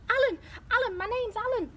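high, loud, thrilled; excited exclamation. Acted. Competition winner named before the crowd, loud and excited. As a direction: sing out the name excitedly.

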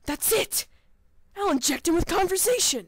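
loud, high burst of inspiration; excited discovery cry; eureka shout. Acted. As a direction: shout the sudden idea. Sudden discovery of cure idea.

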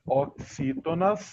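"Oxítonas" drawn out slowly for the pupil; pronúncia demonstrada. Shown as a demonstration. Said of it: "calma aí". ("Oxítonas" pronunciado devagar, bem articulado)

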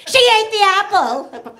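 high, loud, slow tattletale whine; tattling Adam. Retold or imitated. Adam rats Eve out to God, high loud tattle. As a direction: drag it out like a snitching child. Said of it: "Adam rats her out".

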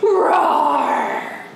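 loud, drawn-out roar; tiger roar. Shown as a demonstration. Reminding children what tigers say, loud roar. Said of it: "what tigers like to say".

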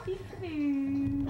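slow, sing-song peekaboo call; drawn-out peekaboo. Real, not acted. Playing peekaboo with the baby.

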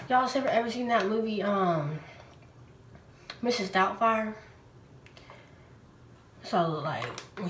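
lips framed by wet sheet; slightly stiff cheek movement; wearing a sheet face mask. Real, not acted. Seen 4: Wet sheet mask covers face throughout; mouth opening left uncovered.

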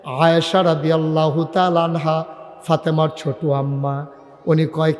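normal talking voice

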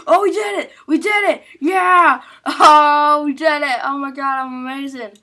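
shouting with giddy relief. Real, not acted. Triumph after surgery success.

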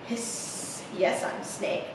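whispered, low-key snake voice; soft hissy whisper; cool confident snake. A narrator's reading. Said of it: "Snake is super confident and cool".

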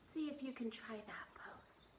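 soft stage whisper. Shown as a demonstration. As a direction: whisper it gently to a child. Whispered encouragement.

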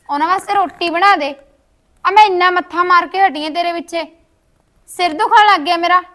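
loud, bossy scolding that builds to a shout; angry ordering. Acted. Sister-in-law orders the girl to cook and make tea, angry shouting.

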